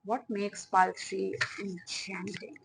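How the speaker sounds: soft, breathy spirit voice; mystic hush. Acted. Reader asking spirit about pile three, hushed and mystical. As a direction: ask it like a secret spell. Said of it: "I don't know why I'm saying it like that".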